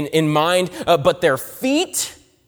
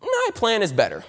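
"My plan is better" pitched up high and defiant; a high, cocky claim that "My plan is better"; stubborn inner voice; 7 semitones higher than usual. Retold or imitated. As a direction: sound like a proud, stubborn believer. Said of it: "but their feet say".

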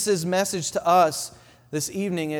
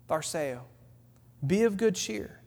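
softer, gently reassuring Tharseo; the Lord's hushed voice; 7 dB quieter than usual. Acted. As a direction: let the words fall quietly like comfort. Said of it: "Can you hear it again?".

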